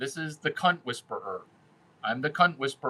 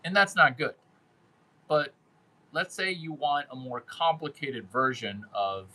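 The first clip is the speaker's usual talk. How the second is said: usual speaking voice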